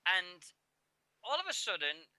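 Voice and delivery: ordinary talk, nothing marked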